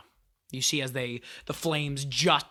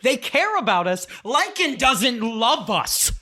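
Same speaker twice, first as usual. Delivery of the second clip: high, raised, scornful echo; mocking retort; 7 semitones higher, 5 dB louder than usual. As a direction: throw it back mockingly, then drag out the last line. Angry emotion mocks Pink's protest.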